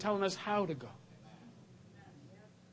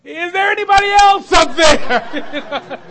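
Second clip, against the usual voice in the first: drawn-out loud shout; 8 semitones higher, 14 dB louder, slower than usual. Retold or imitated. Man on the cliff refusing the offered hand, slow loud shout. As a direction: bellow it slowly, punchline style. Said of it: "And the guy goes".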